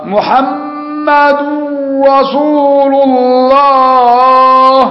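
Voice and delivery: محمد رسول الله chanted very slowly and high, the d of محمد run into رسول; merged dal and ra. Shown as a demonstration. Teacher demonstrating wrongly merged letters in the adhan, slow high chant. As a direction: drawl محمد straight into رسول with no d. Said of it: "إدغام الدال في الراء".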